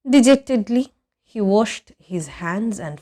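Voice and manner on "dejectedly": ordinary delivery, nothing marked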